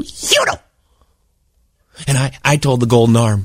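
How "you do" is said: hissed high "You do"; sudden whispered jump-scare. Shown as a demonstration. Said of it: "It goes like this".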